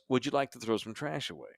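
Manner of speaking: soft-spoken flight attendant politely asking. Retold or imitated. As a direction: gently offer to take the trash. Flight attendant quoted again.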